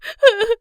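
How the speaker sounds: loud, high acted crying; high childlike sobs. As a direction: sob like a little cub. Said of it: "कियोन बहुत रोता है". (बच्चे जैसी ऊँची सिसकियाँ)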